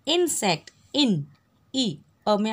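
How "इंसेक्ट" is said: इंसेक्ट with a clear short इ at the start; sounding out the vowel. Shown as a demonstration. Teacher showing pupils the vowel sound, clearly. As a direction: lean on the इ of इंसेक्ट. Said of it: "इसका sound कैसे निकल रहा है".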